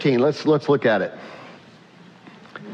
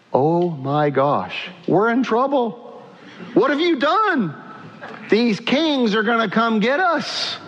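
rising to higher panic; a mock-panicked wife scolding her husband; alarmed wife; 5 semitones higher than usual. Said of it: "And Sarai goes".

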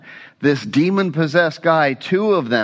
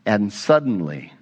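usual speaking voice

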